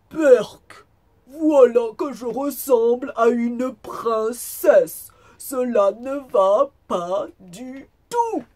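loud, high cry of yuck from the wolf; hammed-up revolted outburst; disgusted exclamation. A narrator's reading. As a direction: exclaim slowly in theatrical disgust. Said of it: "et s'exclama".

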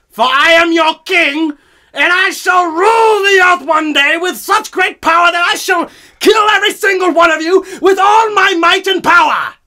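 booming dictator rant, high and loud; roaring tyrant king. Acted. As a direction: roar it like a mad tyrant on a podcast. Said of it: "I channeled my inner Hitler for that one".

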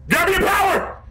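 very high-pitched, strained yell; frantic yell. Real, not acted. Calling for power in game.

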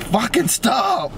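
high, slow retold yell; shouting. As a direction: belt out a pained stop. Retold urge to yell at dentist.

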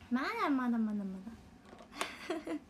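slow, stretched-out repeat; slow drawn-out. Real, not acted. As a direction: drag each まだ out slowly. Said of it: "まだ酔ってないはず".

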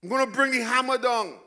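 hammed-up exasperation, fed up; exasperated God. Pastor voicing God fed up with the people, loud exasperation. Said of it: "God doesn't talk like that. That's just me".

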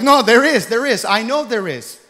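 high, loud no, there is; insistent retold protest; stubborn loud insistence. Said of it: "And I'm like".

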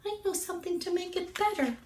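high, quick little bird voice; bird character. A narrator's reading. Bird offers Cat help, high quick voice. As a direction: chirp it quickly as a small bird. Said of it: "Bird said".

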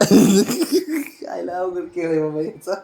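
soft, slow words through real laughter; amused laughing. Real, not acted. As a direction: laugh your way through the remark, drawn out. Amused by the pet's response. (हसत हसत)

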